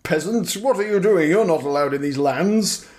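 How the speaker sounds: haughty knight ordering a peasant; pompous knight. Retold or imitated. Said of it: "some pompous knight ... he's like".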